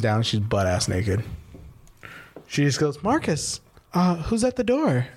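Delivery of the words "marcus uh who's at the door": high-pitched imitation of a girl; put-on high feminine voice; girl's voice. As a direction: call it out high, as the girlfriend. Voices the girl coming downstairs.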